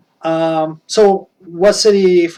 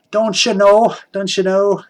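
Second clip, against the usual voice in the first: shaky attempt at a Fargo-style Minnesota accent; 3 semitones higher than usual. Shown as a demonstration. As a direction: try an upper Midwest accent, almost Canadian. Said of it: "I can't do that accent anymore".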